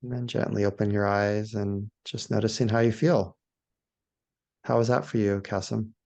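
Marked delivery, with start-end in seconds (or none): 0.0-3.3 low, spacious meditation voice with long pauses; guided meditation. Shown as a demonstration. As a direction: lead them gently outward, one phrase at a time. Leads a guided awe meditation.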